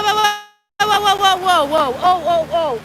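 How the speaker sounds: rising panicked whoas; startled, shouting a warning; alarmed whoa. Real, not acted. Dogs near cliff edge.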